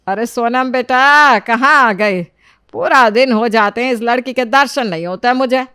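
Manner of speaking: loud, complaining mother's call; noisy calling. Acted. Mother looking for absent Sonam, loud grumbling call. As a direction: holler for your daughter, drawing out her name. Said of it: "क्यों शोर मचा रही हो".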